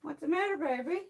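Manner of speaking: soft, high-pitched coo; soothing coo. Real, not acted. Speaker breaks off to comfort baby, soothing coo.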